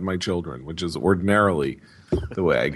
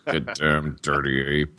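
slow, lower Charlton Heston growl through gritted teeth; a hint of Heston snarling at the ape; Charlton Heston impression; 7 semitones lower, slower than usual. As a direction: grind it out like a furious astronaut. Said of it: "that sounds good".

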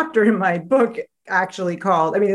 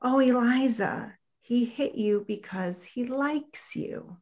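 much softer, slow, slightly higher comforting; soothing mom; 4 semitones higher, 7 dB quieter, slower than usual. Retold or imitated. Neighbor mom comforts crying little Eliza: soft, slow, soothing. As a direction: hush her like a kindly mother.

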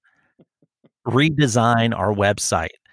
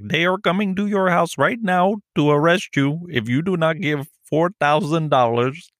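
scammer voice; 7 semitones higher than usual. Retold or imitated. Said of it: "And they're like".

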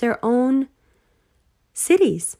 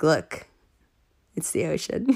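much softer, lower hushed voice; mock ocean voice; 7 semitones lower, 7 dB quieter than usual. The ocean speaking, hushed and low. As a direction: murmur it like the ocean itself.

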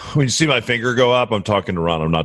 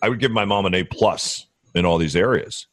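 ordinary talk

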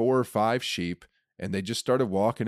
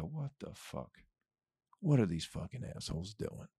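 much softer, peering out the window and muttering; hushed baffled mutter; 11 dB quieter than usual. Retold or imitated. Someone watching neighbors from a window, hushed and baffled. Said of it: "look out your window and go".

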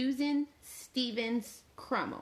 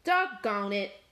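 plain reading voice

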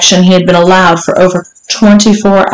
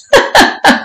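short, high, airy giggle; teasing laugh. Acted. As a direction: laugh lightly at his struggle. Mistress amused by her teasing.

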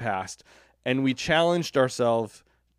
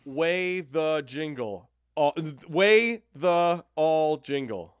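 drawn out, sounding out the reversed clip; slow sounded-out guess; much slower than usual. Retold or imitated. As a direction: stretch each syllable slowly. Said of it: "guessing reversed audio".

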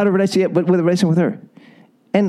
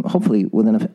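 ordinary talk, nothing marked

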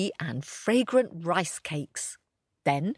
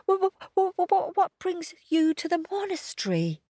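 high, stuttering scared puppy; nervous stutter; 12 semitones higher, faster than usual. A narrator's reading. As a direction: stammer it out, frightened.

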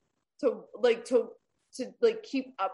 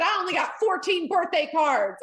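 loud, high imitation of a grievance; hammed-up, raised-voice complaining; mock complaint; 8 semitones higher, 6 dB louder than usual. Mimicking Mary's complaint from the pulpit.

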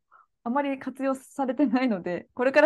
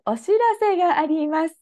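stiff announcement; 5 semitones higher, 4 dB louder than usual. Retold or imitated. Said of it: "彼からしたらなんか".

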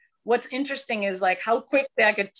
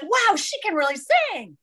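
higher, amazed quoting voice; impressed surprise; 10 semitones higher than usual. Retold or imitated. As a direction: sound pleasantly surprised. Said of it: "they were like".

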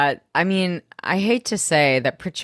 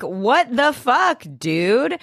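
high, drawn-out outraged reaction; acted-out stunned outburst; shocked disbelief; 8 semitones higher, much slower than usual. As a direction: stretch it out, aghast at your friend. Nate reacts to hearing about affair.

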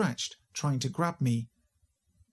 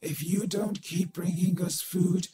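put-on distorted little girl voice; 3 semitones higher than usual. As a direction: drag it out like a creepy possessed girl. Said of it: "In a distorted little girl's voice".

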